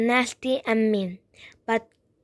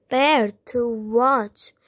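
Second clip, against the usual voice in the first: ordinary delivery, nothing marked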